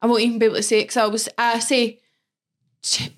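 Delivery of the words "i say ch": ordinary delivery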